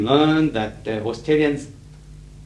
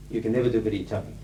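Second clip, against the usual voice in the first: usual speaking voice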